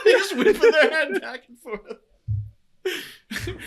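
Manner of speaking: slow, laughing shout; cracking up while saying it. Real, not acted. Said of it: "laugh shout".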